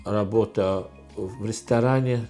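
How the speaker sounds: ordinary delivery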